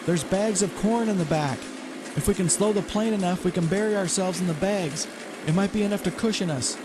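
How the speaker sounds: lightly voiced woman, urgent. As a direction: call out over the engines. Natalie's voice over engine noise.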